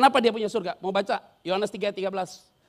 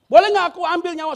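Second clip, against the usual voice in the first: pleading Satan; 9 semitones higher, 12 dB louder than usual. Acted. Satan asks God permission.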